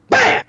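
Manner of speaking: sudden high, loud bang; explosive vocal bang; imitated gunshot. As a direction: burst out with a gunshot noise.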